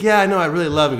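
put-on high, loud smitten young woman; gushing girl. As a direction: gush like a girl in love. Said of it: "And she's like".

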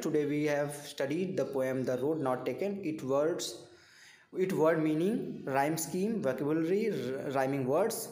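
very slow, word by word; spelling it out slowly for note-taking; slow dictation. Shown as a demonstration. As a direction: read it out like dictation to students. Dictating a lesson plan.

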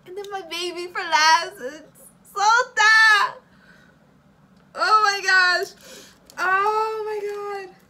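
high, loud and slow joyful cries; ecstatic reveal. Real, not acted. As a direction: savour the big reveal. Reveals favourite card.

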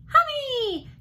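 put-on very high, loud toddler squeal; excited toddler cry. A narrator's reading. Little boy racing into the room, crying out excitedly. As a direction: shriek it like a delighted tiny child. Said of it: "Derek cried as he raced".

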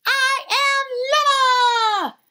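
high, slow, loud grand cry; triumphant proclamation. A narrator's reading. Llama returns to the universe, high triumphant cry. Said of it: "proclaimed Llama".

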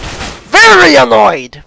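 high, loud, drawn-out annoyed yell; loud exasperated wail. Real, not acted. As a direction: stretch VERY ANNOYED into a long falling shout.

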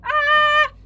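very high, loud sustained shriek; piercing held "ah"; startled scream. Real, not acted. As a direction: shriek in sudden fright. Startled in game.

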